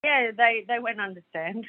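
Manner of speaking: usual speaking voice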